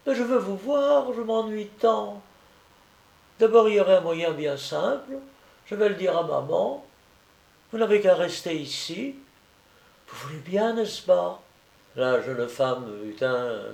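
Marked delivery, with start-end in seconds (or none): 0.1-11.4 put-on little-boy voice, high at the start; pleading child. A narrator's reading. Sick boy begs his friend to stay, pleading. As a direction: coax her like a hopeful child.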